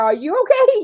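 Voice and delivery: retold frantic mom; frantic question. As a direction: squeal it with frantic worry. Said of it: "I'm like".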